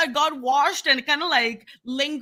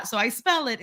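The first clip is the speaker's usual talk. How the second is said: normal talking voice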